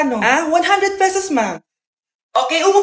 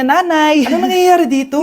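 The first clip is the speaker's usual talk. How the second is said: ordinary talk, nothing marked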